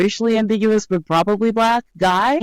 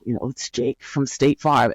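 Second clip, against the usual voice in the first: creepy mouth-breather voice; 7 semitones lower, 3 dB quieter than usual. Retold or imitated. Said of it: "in the same tone of voice".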